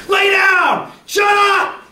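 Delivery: high shouted orders; retold screaming. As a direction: bark the orders like angry nurses. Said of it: "'Cause they're screaming at me".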